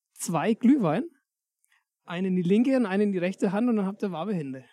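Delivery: ordinary talk, nothing marked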